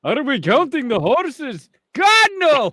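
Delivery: high swooping question into a loud wail; mock dismay. Villager dreading the count of horses, hammed-up dismay.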